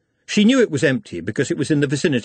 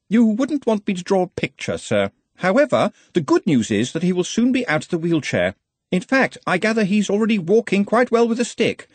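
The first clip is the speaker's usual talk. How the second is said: high-pitched, insistent subordinate; earnest, fussy assistant; 9 semitones higher than usual. Acted. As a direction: remind your superior of the rules in a higher voice. Ponder reports to his superior.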